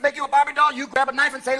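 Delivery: ordinary talk, nothing marked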